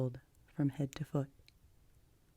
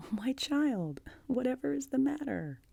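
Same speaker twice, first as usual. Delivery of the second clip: high, startled exclamation; startled concern; 10 semitones higher than usual. A narrator's reading. Guardian finds the child crying, startled and concerned. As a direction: exclaim in sudden alarm, pitched high.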